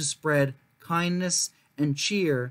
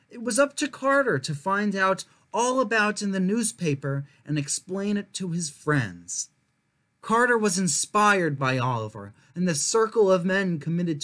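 measured, expressive read-aloud voice; storybook narration; 3 semitones higher than usual. A narrator's reading. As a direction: tell it like a picture book for kids. Reads a children's history book aloud.

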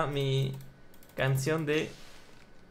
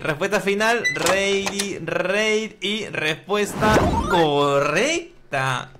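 slow, drawn-out verdict; suspenseful quiz host; 4 dB louder, much slower than usual. Acted. Host revealing the contestant's final answer, suspenseful presenter voice. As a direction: stretch the reveal like a game show host.

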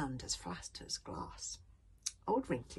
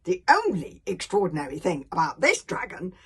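put-on aggrieved boy; 3 semitones higher, 11 dB louder than usual. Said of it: "continued Hiccup".

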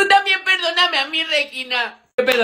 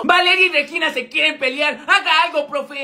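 normal talking voice

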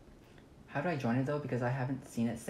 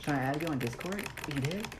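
ordinary talk, nothing marked

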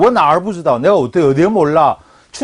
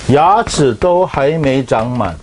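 slow dictation; 3 semitones lower, much slower than usual. Shown as a demonstration. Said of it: "한국말로 뭐?".

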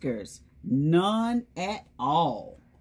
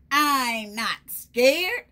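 put-on higher, louder, slow and defiant child voice; brave little Gruffalo's child; 6 semitones higher, 5 dB louder, slower than usual. Reading to the kids as the Gruffalo's child, high and defiant. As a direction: puff up like a small monster pretending to be brave. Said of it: "said the Gruffalo's child".

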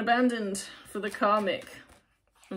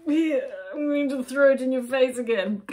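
louder mock-resentful retort; resentful jab; 5 semitones higher, 8 dB louder than usual. Voicing a resentful partner to the client, mock-bitter jab. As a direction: throw it back at them, a little hammed-up.

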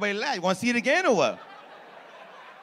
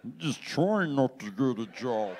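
slowed, stretched words, slightly lower and softer; slow-motion replay; 6 semitones lower, 4 dB quieter than usual. Shown as a demonstration. Replaying the tattoo roast for the crowd in slow motion. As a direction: drag each word as if on rewind. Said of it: "want me to do it slower?".

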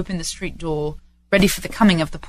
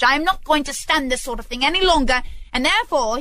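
raised-pitch, put-on indignation; mock stern; 7 semitones higher than usual. Scrooge teases late Bob, mock indignant. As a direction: build a pretend telling-off.

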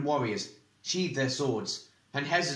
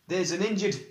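urgent; 7 semitones higher than usual. A narrator's reading. Eragon urgently interrupting.

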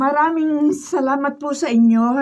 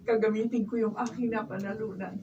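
much softer, gentle reassurance; tender; 4 semitones lower, 12 dB quieter than usual. Acted. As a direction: comfort her softly. Mother tenderly comforts her daughter. (malambing at mahinang pag-alo)

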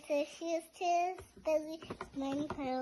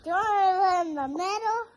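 sheep voice; 4 semitones higher, 9 dB louder, faster than usual. Acted. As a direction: drag it out like a big sheep.

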